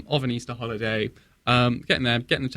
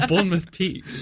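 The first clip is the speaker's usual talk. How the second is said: laughing; 5 semitones higher than usual. Real, not acted. Joking about the Bournemouth mountain.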